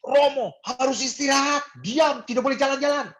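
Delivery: high, loud scolding as a strict doctor; imitating a yelling doctor. Strict doctor ordering the priest to rest, yelling. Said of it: "dia itu teriak-teriak".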